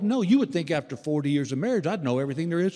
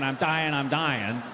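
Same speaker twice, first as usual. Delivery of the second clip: ordinary talk, nothing marked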